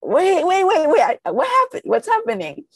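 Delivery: high, loud mock panic; hammed-up squeal of bewilderment; flustered subconscious mind. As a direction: scramble in comic confusion. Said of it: "your subconscious mind's like".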